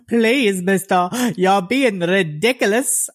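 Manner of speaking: high, drawn-out mock plea; tearful begging woman. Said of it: "kept crying about her child".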